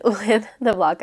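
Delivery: normal talking voice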